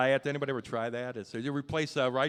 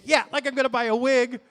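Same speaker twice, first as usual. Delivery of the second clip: much higher and louder, sarcastic outrage; indignant retort; 8 semitones higher, 9 dB louder than usual. Retold or imitated. Comic answers a woman about a wig, loud sarcastic retort. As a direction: snap back in mock offense.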